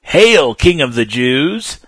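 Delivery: loud, high, slow mock salute; mocking hail. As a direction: jeer it like a fake royal greeting. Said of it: "mocked him".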